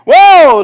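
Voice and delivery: very loud, high whoa of wonder; excited whoa. Acted. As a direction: shout it with delight. Bold believer shouting joy.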